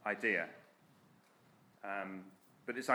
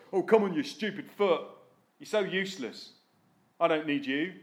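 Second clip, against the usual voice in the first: louder, contemptuous scolding; scornful hand; 2 semitones higher, 4 dB louder than usual. Acted. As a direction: sneer at the foot.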